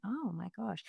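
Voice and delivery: hushed astonished murmur; stunned. Retold or imitated. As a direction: let it slip out quietly, awed. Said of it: "I remember going".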